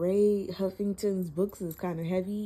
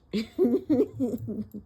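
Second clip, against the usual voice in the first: real laugh; 6 semitones higher, 5 dB louder than usual. Real, not acted. Laughing at the book.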